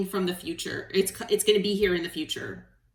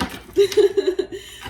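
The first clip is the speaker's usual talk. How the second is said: high, loud burst of laughter; emotional laugh; 11 semitones higher, 8 dB louder than usual. Real, not acted. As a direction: laugh while holding back tears. Laughs while holding back tears.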